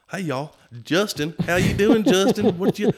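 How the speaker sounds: high, loud mock Southern greeting; Southern accent. Imitating Southerners greeting him by name, high and loud. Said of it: "people from the South".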